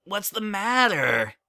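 put-on high, bouncy kid voice; young colt voice. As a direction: play an eager little colt. Child character voice.

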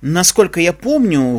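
ordinary delivery, nothing marked

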